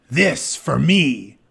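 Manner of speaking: slow, loud, heroic declaration; proud warrior voice, slow and loud; triumphant claim. Acted. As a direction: claim the sword like a hero. Achilles seizes the sword.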